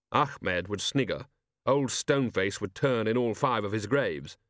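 ordinary delivery, nothing marked

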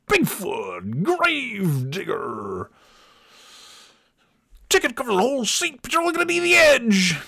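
high, hyped, racing announcer parody; mock ad announcer. Comedian spoofing the arena ad: rising, frantic announcer parody. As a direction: climb into a frantic hype-man pitch. Imitating the monster truck ad.

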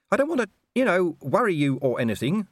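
high-pitched whiny dog voice; whining. A narrator's reading. Gaspode anxiously cautions a friend in the snow, whining. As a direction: whine it, reluctant to worry them. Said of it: "whined Gaspode".

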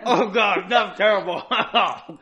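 pained laughter through the words; laughing in pain. Real, not acted. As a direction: laugh it out while it burns. Reacting to burning bite, laughing.

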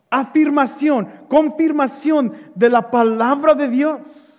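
ordinary talk

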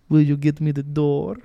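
coy, breathy-sounding flirt asking sweetly; mock sultry customer. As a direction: sweet-talk him for the door.

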